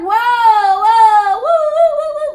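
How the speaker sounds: high, loud, sing-song whoas; shocked whoa. Retold or imitated. Shocked exclamations.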